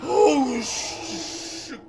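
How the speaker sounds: high loud shriek, then a breathy curse; real fright scream; scared scream. Real, not acted. Jump scare in horror game.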